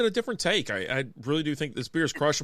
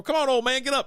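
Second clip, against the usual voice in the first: higher, louder playful taunt; teasing call; 9 semitones higher, 3 dB louder than usual. Retold or imitated. Son teasing his dad on the couch, louder. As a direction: rouse him playfully. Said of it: "I go".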